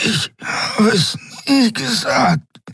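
high, slow strangled voice; thin as mist. A narrator's reading. Choked captive denying what he said, thin and strangled. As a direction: force it out slowly through a squeezed throat. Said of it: "Seine Stimme war dünn wie Nebel".

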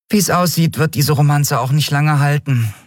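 high-pitched small voice, a touch regretful; sighing. Acted. Nelen doubts the romance will last, sighing. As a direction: sigh it lightly from on high. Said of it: "seufzte die Jadi schließlich".

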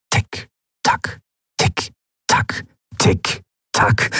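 hushed, toneless clock ticks; ticking clock. A narrator's reading. Clock counting down his remaining time, whispered ticking. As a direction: tick like a clock, spaced and unvoiced. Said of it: "Das Ticken der Standuhr".

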